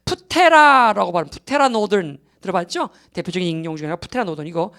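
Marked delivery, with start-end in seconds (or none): 1.3-1.7 "푸테라"의 첫 p를 "푸"로 살려 크게 발음; "푸테라"라고 푸를 또렷하고 높게 내어 잘못된 발음 시범. Shown as a demonstration. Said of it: "일본에도 이걸 피를 발음을 해버린 거예요". ("푸테라" 첫소리 푸를 강하게 살려서)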